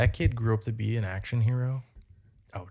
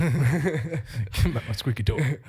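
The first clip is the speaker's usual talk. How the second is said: mock gangster; much slower than usual. Repeating friend's gangster quote.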